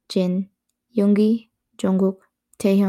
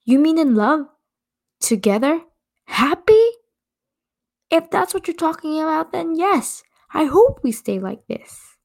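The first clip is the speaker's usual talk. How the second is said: defiant retort; 8 semitones higher than usual. Acted. Jimin answering his girlfriend's doubts, indignant. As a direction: throw it back, hurt and defiant. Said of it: "He retorted".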